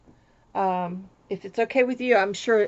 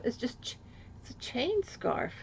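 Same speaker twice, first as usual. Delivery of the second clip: whispering; 2 semitones higher, 10 dB quieter than usual. Real, not acted. Quiet aside on the scarf.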